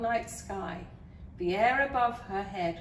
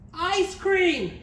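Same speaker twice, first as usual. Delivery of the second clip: high, loud bratty princess shout; shouted demand; 10 semitones higher, 11 dB louder than usual. A narrator's reading. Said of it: "she shouted".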